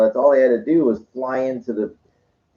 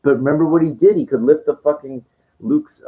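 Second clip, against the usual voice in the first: normal talking voice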